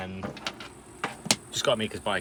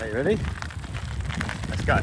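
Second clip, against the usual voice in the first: raised call to a companion; 5 semitones higher, 5 dB louder, faster than usual. Real, not acted. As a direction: call her over. Calling to companion.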